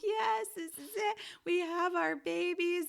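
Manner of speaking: high, overwhelmed new-mother voice; tearful joy, retold. New mother seeing both babies, tearfully joyful. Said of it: "I start crying".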